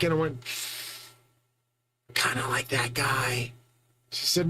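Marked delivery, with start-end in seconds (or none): 2.1-3.5 hushed, breathy whisper; low secretive whisper. Retold or imitated. Whispering the answer to girlfriend.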